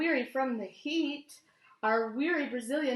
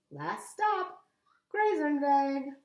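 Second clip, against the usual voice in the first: shouts; 4 semitones higher, 5 dB louder, slower than usual. A narrator's reading. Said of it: "and shouts".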